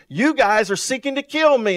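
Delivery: forceful quote. Retold or imitated. Preacher voicing Jesus to the Pharisees, loud and accusing. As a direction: call them out loud from the pulpit.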